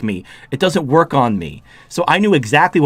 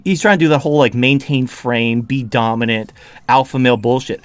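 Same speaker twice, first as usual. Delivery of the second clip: normal talking voice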